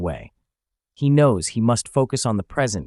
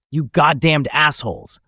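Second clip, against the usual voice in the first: higher, louder outburst of insult; angry shout; 6 semitones higher, 5 dB louder than usual. A narrator's reading. Mugang at the ascetics who saved him, angry shout. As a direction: shout it with frustration spilling over. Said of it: "Mugang shouts, his emotions spilling over".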